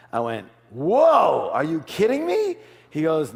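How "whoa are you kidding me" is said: higher, loud amazed whoa; astonished outcry at the accountant's figure. Retold or imitated. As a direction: gasp at the big number. Amazed at the company valuation.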